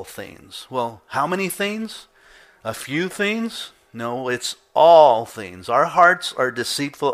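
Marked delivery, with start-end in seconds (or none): 1.1-3.7 high, questioning lilt; rhetorical questioning. Acted. Preacher quizzing the congregation on how many, rhetorical questioning. 3.9-5.7 "all" drawn out slow and loud; stress on all. Real, not acted. Preacher answering his own question to the congregation, emphatic "all".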